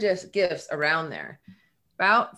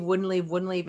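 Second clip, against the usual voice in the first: normal talking voice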